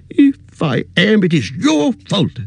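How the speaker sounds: put-on mild angry old hobbit. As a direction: snap back, defending what is yours. Bilbo angry.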